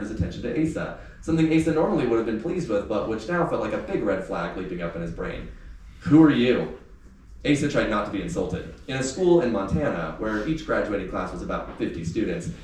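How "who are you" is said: sneering who are you, loud; loud scornful. A narrator's reading. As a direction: bark it down at him with contempt. Carter challenges Asa.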